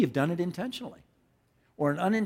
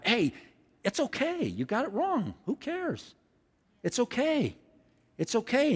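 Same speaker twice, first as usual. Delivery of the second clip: gentle it's-okay voice; reassuring; 7 semitones higher than usual. Acted. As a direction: comfort someone who just got it wrong. Voicing the forgiving multiplier.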